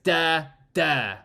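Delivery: "Da, da" both syllables hit hard and loud; two equal strong beats; spondee. Shown as a demonstration. Teacher demonstrates the spondee, both beats stressed. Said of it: "where it's both stressed".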